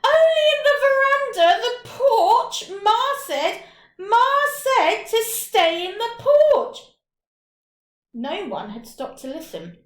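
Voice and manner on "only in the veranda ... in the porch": high, loud crying plea, slowing; loud, high wailing protest through tears; tearful calling out. Acted. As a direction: cry it out to friends who have already gone. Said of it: "when he called out".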